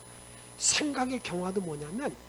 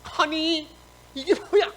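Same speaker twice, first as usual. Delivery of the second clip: higher, loud indignant outburst; acted anger; 6 semitones higher, 7 dB louder, slower than usual. Angry Naaman protests his treatment, acted indignant outburst. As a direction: protest hotly as the offended man. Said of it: "화를 내면서".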